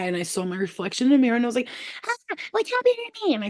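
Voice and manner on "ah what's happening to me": very high, fast shriek of alarm; mock panic in a tiny squeaky voice; little green guy. Little green guy seeing the mirror, squeaky panic. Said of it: "green fucking guy".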